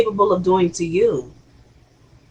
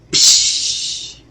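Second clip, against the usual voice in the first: stool smash noise; 4 dB quieter than usual. Retold or imitated. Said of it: "Jock takes a freaking stool".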